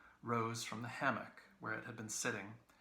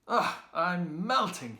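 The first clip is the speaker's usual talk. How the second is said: high, loud, slow groan of relief; mock melting; 8 semitones higher, 11 dB louder, slower than usual. A narrator's reading. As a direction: ham up the heat. Sighing with relief.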